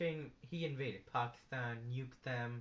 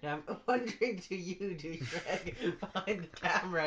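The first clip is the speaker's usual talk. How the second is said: louder, laughing as he calls out; laughing callout; 5 semitones higher, 3 dB louder than usual. Real, not acted. Comic laughing at the douchebag behind the camera. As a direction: laugh while pointing at the guy filming.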